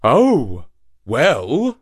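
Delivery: hesitant donkey. A narrator's reading. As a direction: give in reluctantly. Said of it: "said the donkey".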